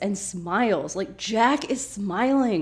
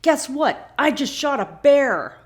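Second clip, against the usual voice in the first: higher, louder smug brag; proud boast; 6 semitones higher, 6 dB louder than usual. Retold or imitated. Sawyer brags to the group, loud proud boast. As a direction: show off the kill. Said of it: "and Sawyer's like".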